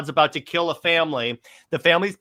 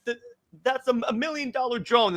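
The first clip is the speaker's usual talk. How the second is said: higher, exasperated mock rant; mock outraged general; 9 semitones higher than usual. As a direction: huff like a furious officer. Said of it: "Swanwick is like".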